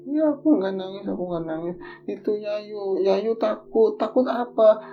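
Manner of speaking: put-on high, small voice of a frightened child; scared little boy. Small boy telling his father that his sister saw a ghost, high and frightened. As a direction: sound like a little kid spooked.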